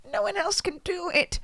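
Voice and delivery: high, put-on mother's voice; light high quoted voice; mock mom voice. Mom's quoted line, put-on voice.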